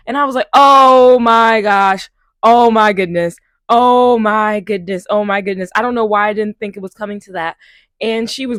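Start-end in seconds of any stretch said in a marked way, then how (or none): 0.5-5.7 loud, drawn-out shocked gasp, fading; slow, loud disbelief that fades; stunned disbelief. Retold or imitated. Shocked by "I am God" talk.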